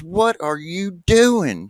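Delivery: high, loud, slow cry of disgust; hammed-up horrified yell; shocked shout. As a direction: drag it out, horrified. Man shocked, jumps off bed.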